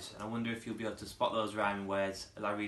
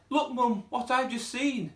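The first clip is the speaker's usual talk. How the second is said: put-on high, eager child voice; excited little girl; 13 semitones higher, 4 dB louder, slower than usual. Girl spotting a flying saucer, excited high child voice. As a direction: tug at Mum's attention, thrilled.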